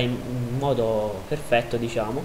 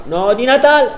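alarmed shout; 11 semitones higher, 9 dB louder than usual. Real, not acted. Opponent striker attacking.

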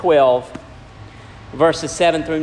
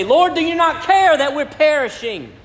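high, frantic cry; panicked disciples pleading over the storm; panicked plea; 10 semitones higher than usual. Retold or imitated. Disciples waking Jesus in storm.

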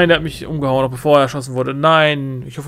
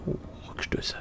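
leise zu sich selbst geflüstert; flüster es vor dich hin; 13 dB quieter than usual. Real, not acted. Reading upgrades to himself. (geflüstert)

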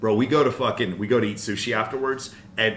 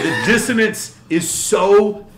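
cracking up at how absurd it is; laughing exasperation; 11 semitones higher, 8 dB louder than usual. Real, not acted. Laughing at his girlfriend's contradiction, loud and high.

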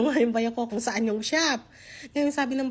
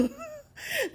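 light laugh; 6 dB quieter than usual. Real, not acted. Laughs about friendly shop ladies.

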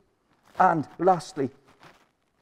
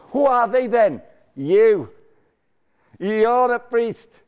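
loud preaching; 4 semitones higher, 6 dB louder, much slower than usual. Real, not acted. Preacher calling out answer.

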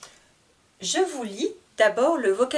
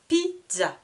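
"Pizza" cut in two, Pi... zza; split into syllables; 6 semitones higher than usual. Shown as a demonstration. Teacher showing two syllables, "Pizza" split Pi... zza. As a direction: separate both beats of "Pizza". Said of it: "Pareil, deux syllabes".

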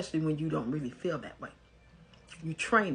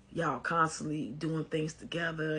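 ordinary talk, nothing marked

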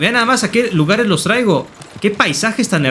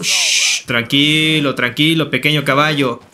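soothing; 6 semitones lower, slower than usual. Acted. Calming the agitated horse.

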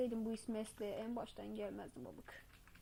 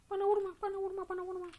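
high, louder pleading cry; mock pleading; 7 semitones higher, 4 dB louder, faster than usual. Player begging the jumping old man not to hit him, high plea.

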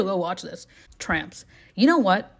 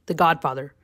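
usual speaking voice

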